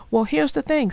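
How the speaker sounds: usual speaking voice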